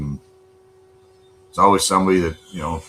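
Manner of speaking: normal talking voice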